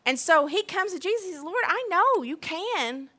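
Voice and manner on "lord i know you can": acted begging. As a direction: plead earnestly, stretching the key words. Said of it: "that's why people are begging God".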